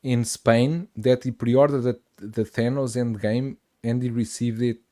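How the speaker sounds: usual speaking voice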